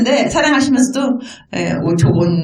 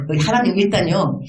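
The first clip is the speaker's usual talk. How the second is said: ordinary talk, nothing marked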